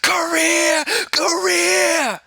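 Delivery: high, loud mocking shout. Mocking careerist art kids, high loud shout. Mock climax of rant.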